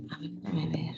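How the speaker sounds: whispered aside to herself; barely voiced, under the breath; whispering. Real, not acted. Teacher fixing audio, muttering aside.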